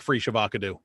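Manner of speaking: FREE SHAVACADO as "free shuh-VOCK-uh-doo"; vine quote mispronunciation. Retold or imitated. Host quoting a vine about avocado, playful "free shuh-VOCK-uh-doo". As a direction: run the words together, stress on VOCK, ending "doo". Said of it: "I fucking love that vine".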